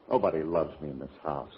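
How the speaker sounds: self-pity. Acted. Self-pitying sulk.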